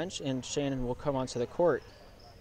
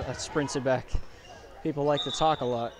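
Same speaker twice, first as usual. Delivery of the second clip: ordinary talk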